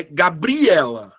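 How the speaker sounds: "Gabriela" slowly, wrongly stressed ga-BRI-ela. Shown as a demonstration. Teacher offers students a second option, stress on BRI. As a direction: lean hard and long on the BRI of "Gabriela". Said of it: "qual é a sílaba que eu falo mais forte".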